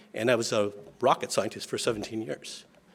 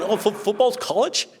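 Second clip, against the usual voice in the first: put-on high, amazed kid voice with a laugh; childlike surprise; 10 semitones higher, 4 dB louder than usual. Little brothers hearing football is college, high astonished laugh. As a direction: gasp it out like a baffled little boy.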